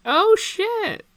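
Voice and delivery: high, loud surprised yelp. Real, not acted. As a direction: caught off guard, delighted. Discovers hidden art view.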